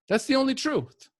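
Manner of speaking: high, passionate emphasis on the only truth; lifted, fervent insistence; rising fervour. Real, not acted. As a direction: preach it with rising conviction. Preaching unconditional love.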